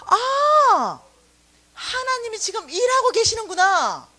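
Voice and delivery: high cry of realization; joyful outcry. Retold or imitated. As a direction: cry out in sudden joy. (깨달음에 벅차 높게 외치며)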